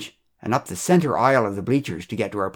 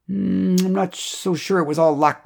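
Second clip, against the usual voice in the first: higher, drawn-out hum into hedging; mildly sceptical, pitched-up character voice; doubtful reply; 8 semitones higher than usual. A narrator's reading. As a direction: hedge with a long "mm". Chuck's doubtful, sheepish reply in story.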